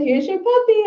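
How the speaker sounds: high, jokey imitation of a teasing relative; mocking singsong-high voice. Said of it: "thought they were funny".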